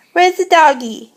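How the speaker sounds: ordinary talk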